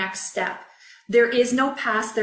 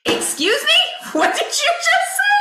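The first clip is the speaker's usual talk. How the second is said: retold shocked squeak, much higher, slowing then rushing; stunned disbelief; 5 dB louder than usual. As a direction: replay the moment the news hit, half laughing. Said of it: "I was like".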